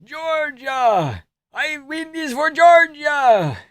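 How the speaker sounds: put-on high, slow accented victory cry; proud high-pitched Georgian yell; Merab impression. Merab voiced celebrating.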